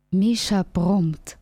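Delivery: ordinary delivery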